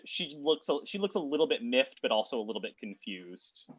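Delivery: normal talking voice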